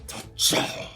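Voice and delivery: booming regal command from the giant; regal giant call. A narrator's reading. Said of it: "raises one hand regally and calls out".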